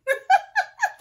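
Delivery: a sudden high-pitched burst of laughter; nervous laugh. Real, not acted. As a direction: let a nervous giggle jump up high. Nervous excitement cutting own wig.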